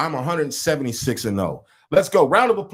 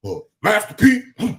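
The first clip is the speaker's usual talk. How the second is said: imitating co-host, higher and excited; 5 semitones higher than usual. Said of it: "You were like".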